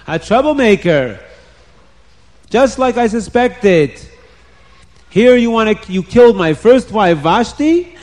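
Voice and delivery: storybook king working up his anger; angry king. A narrator's reading. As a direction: accuse him like an outraged king. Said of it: "the king got very angry at Haman".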